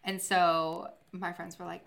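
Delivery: ordinary delivery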